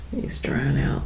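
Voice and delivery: unvoiced whisper; soft whispered aside about the light. Real, not acted.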